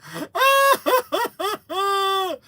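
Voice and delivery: very high imitated sobs; hammed-up wailing boo-hoo; long, high fake crying; mock bawling. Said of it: "and he's bawling".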